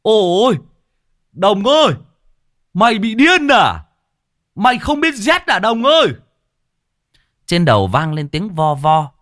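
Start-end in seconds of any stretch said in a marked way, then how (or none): 0.0-6.1 high, loud, alarmed cry to his friend; calling out in alarm. A narrator's reading. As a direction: yell across the yard at a madman. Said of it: "Lâm Sẹo kêu lên".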